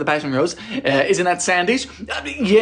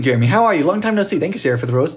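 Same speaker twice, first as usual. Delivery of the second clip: normal talking voice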